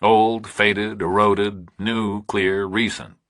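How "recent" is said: ordinary delivery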